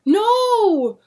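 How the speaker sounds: very high loud scream; scream of protest. Acted. William protesting the burning, desperate scream. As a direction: howl like a desperate animal. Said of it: "William's scream of protest".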